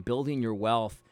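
usual speaking voice

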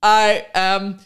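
slow, halting mock Japanese-accented English; imitated Japanese accent. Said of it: "he says it just like that".

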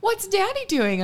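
put-on high, slow little-kid voice; curious toddler. Toddler on the potty asking mom, high curious voice. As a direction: ask it like a small child. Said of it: "she's like".

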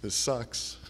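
quiet, sarcastic aside with a laugh; fake smiling agreement. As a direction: grin while grumbling under your breath.

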